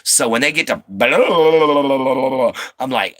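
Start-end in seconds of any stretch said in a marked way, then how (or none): 0.9-2.5 rolling nonsense babble, pitched up; mock fast Cajun babble. Imitating Cajun he can't follow, mock babble. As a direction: burble gibberish like speech too fast to follow. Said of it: "when you straight up get French Cajun".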